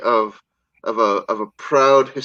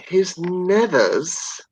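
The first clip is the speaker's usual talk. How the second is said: slow his nethers; 4 semitones higher, much slower than usual. Real, not acted. Savouring a funny word.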